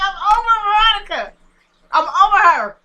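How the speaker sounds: loud, high outburst of exasperation; exasperated yelling. Real, not acted. Vlogger fed up with Veronica, yelling on camera. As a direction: yell it out, fed up. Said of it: "where you should be yelling on camera".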